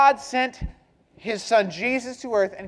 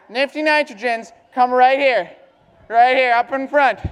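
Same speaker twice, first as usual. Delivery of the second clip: calling out; 4 dB louder, faster than usual. Real, not acted. Calling group to front.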